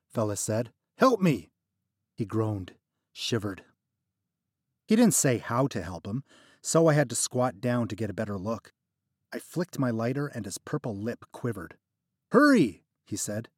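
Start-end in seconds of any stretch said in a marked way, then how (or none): 1.0-1.5 higher, louder desperate plea; pleading. Acted. Trapped friend begging narrator for help. 12.3-12.8 much higher, louder urgent cry; urgent plea. Acted. Freezing friend urging narrator to hurry.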